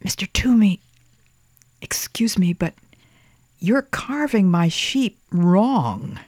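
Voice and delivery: put-on high, slow, hesitant boy; timid child voice. A narrator's reading. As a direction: ask carefully as a small boy. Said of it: "Thomas whispered".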